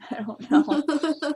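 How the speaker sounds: slow, drawn-out silly reply; slow goofy voice. Pretend. Shrugging at what the wizard cooks, in a slow goofy voice. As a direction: stretch it out like a dopey wizard.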